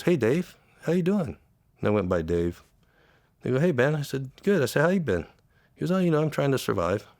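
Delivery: high, tight voice holding back emotion; real emotion rising in the throat; choked up. Real, not acted. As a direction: retell it while fighting back tears. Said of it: "I don't know why I get like this".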